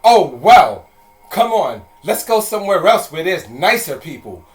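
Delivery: lightly mock the huffy grandmother; haughty old lady sniffing and leaving; snooty old lady. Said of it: "rolled her eyes and was like".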